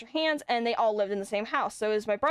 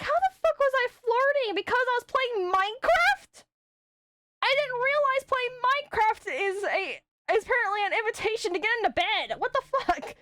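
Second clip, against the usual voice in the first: incredulous retort; 11 semitones higher than usual. Retold or imitated. Retold rant about Minecraft flirting.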